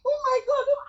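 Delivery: high thrilled gasp; excited squeal. Retold or imitated. Seeing the money, high thrilled squeal. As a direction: squeal it in disbelief. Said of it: "I said".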